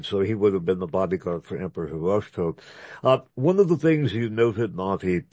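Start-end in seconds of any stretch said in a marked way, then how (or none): none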